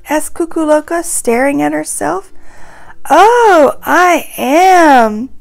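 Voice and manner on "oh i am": delighted gasp. Acted. Kukuloka delighted at herself.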